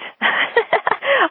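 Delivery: a burst of high laughter at herself; high self-mocking laugh. Real, not acted. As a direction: laugh high at how bad you used to be. Laughing at her old self.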